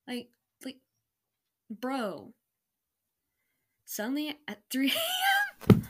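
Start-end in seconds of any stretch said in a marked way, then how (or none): 3.9-5.8 high, loud mock-dramatic exclamation; dramatic cry. Real, not acted. Reading her old story, she shouts the reveal in mock drama.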